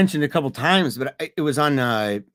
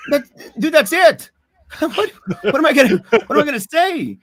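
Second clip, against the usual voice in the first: laughing; 11 semitones higher, 5 dB louder than usual. Real, not acted. Laughing at own point.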